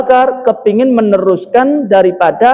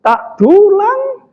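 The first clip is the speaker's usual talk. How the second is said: high, drawn-out outburst of acted outrage; acted seething, pitched high; seething indignation; 7 semitones higher than usual. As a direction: play a man seething at an insult. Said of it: "Keret-keret Sayyidina Abu Bakar ya".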